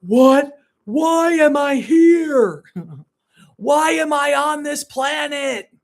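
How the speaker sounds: high, slow anguished yell at the sky; retold scream. On her knees in the bedroom, screaming at God. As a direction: cry out to the universe, begging. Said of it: "and I'm screaming".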